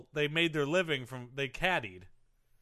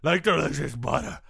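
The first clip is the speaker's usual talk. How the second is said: Tubby Tom character voice; 6 semitones lower than usual. Acted. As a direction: boast about the weight loss in character. Guest plays bloated Tubby Tom.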